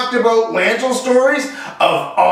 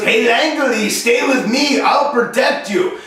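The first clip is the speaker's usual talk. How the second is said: slightly raised French Canadian teammate voice; mock French Canadian accent; 3 semitones higher, slower than usual. French Canadian gamer tells teammate to stay close, mock accent. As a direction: imitate a Quebec French accent. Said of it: "he was French, he was from Quebec".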